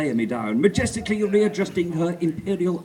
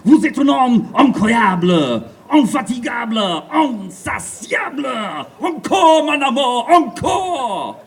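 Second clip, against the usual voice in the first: loud, higher, slow French ecstatic cries; panting French lover; 6 semitones higher, 9 dB louder, slower than usual. Acted. Gigi begging Armitage for more, in a French accent. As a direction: gush it like a swooning French lover. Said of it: "she panted".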